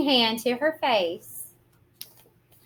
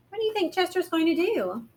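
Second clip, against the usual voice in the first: usual speaking voice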